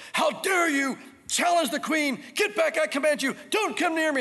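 high, imperious shouted command; indignant shout. Acted. Jezebel to servants about to seize her, indignant shouting. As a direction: order them back like an outraged queen. Jezebel orders attackers away.

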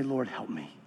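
low, soft and worn-out plea; hushed plea. Shown as a demonstration. Preacher shows the grieving how to pray, hushed. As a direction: say it like you can barely speak. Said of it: "And just say".

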